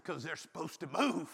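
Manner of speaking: low, soft, laughing excuse; sheepish reply. Retold or imitated. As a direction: mumble the excuse with a chuckle. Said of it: "I said".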